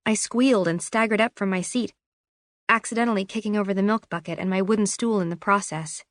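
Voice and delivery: plain reading voice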